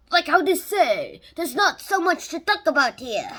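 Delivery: put-on jokey Spanish accent while presenting tigers; mock Spanish accent. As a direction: ham up a Spanish accent for fun. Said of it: "I'll stop the Spanish accent here".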